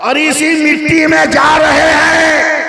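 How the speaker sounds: very high, sustained oratorical shout; rousing high-pitched sermon climax; full-force preaching. Real, not acted. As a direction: hurl each phrase at the crowd, endings stretched.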